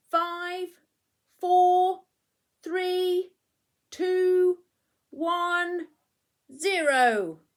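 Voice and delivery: high, loud, eager countdown to launch; excited countdown. Acted. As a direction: call out each number like a rocket launch. Bramwell leads the launch countdown.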